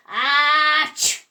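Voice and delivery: big loud ah-choo; a hammed-up sneeze; loud fake sneeze. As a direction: sneeze right in his ear. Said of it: "Cow went up to Dog and went".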